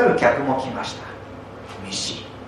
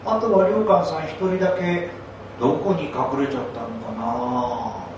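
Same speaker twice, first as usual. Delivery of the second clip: ordinary delivery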